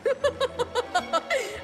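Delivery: high gleeful cackle; evil cackle. Acted. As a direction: cackle in triumph. Villain sure girls fail.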